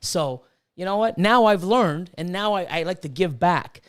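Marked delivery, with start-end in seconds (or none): none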